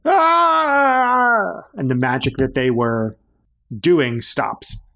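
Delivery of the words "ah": sharp high cry of pain; pained scream. Acted. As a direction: yelp as the blade cuts. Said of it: "they let out a scream".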